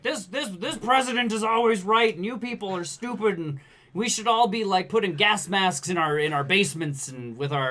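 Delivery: high, loud mock news-show tirade; ranting TV pundit. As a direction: imitate a shouty host spouting crazy stuff. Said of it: "this guy shouts off crazy stuff".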